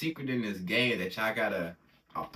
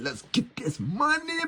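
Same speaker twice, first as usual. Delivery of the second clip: excited shout; 11 semitones higher than usual. Real, not acted. Trader hyping his climbing trade, excited shout. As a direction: shout it out, pumped about the win.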